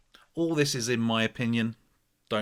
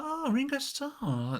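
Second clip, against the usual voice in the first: high clueless echo; puzzled customer; slower than usual. Retold or imitated. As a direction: play her not knowing him. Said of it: "She's like".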